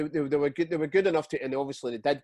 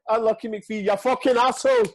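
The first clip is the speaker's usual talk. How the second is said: mocking fan shout; 8 semitones higher, 7 dB louder than usual. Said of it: "and he went".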